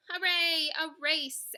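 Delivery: loud, high hooray; cheering. A narrator's reading. Animals hearing there will be a race, cheering. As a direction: cheer like a whole crowd. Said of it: "everyone cheered".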